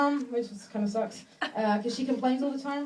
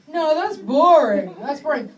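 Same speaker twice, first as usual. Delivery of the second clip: slow, drawn-out, louder "boring"; mock bored partner; 3 semitones higher, 11 dB louder, slower than usual. Couple planning date night, dragged-out bored complaint. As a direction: drag out the complaint like a bored spouse.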